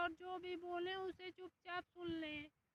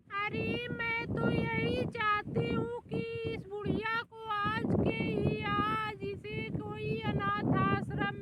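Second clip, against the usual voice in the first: angry demand; 2 semitones higher, 7 dB louder than usual. Acted. Wife demands mother-in-law be sent away.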